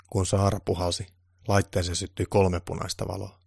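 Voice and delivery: ordinary delivery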